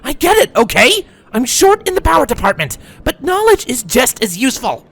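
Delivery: high, loud defensive outburst; heated snapping. A narrator's reading. Sunburst glares at fillies, defending knowledge, heated snapping. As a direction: snap back in your own defence. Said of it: "Sunburst snapped, sitting up and glaring".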